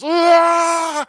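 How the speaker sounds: high, loud imitated battle cry; mock rage scream. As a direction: let out a put-on furious yell. Imitates girl's rage before attacking.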